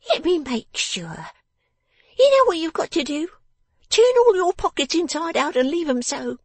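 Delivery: very high, loud scolding voice; crying out bossily. A narrator's reading. Miss Wren orders her father to empty his pockets, shrilly bossy. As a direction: command him like a tiny tyrant, high and loud. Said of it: "cried the person of the house".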